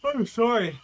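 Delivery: talking through a yawn; words stretched by a yawn. Real, not acted. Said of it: "I'm sorry I keep yawning".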